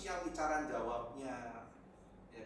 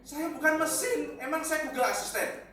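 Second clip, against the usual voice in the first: loud protest; 11 semitones higher, 12 dB louder than usual. Real, not acted. Pastor tells the congregation he is no machine, loudly indignant. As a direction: insist on it, nearly shouting.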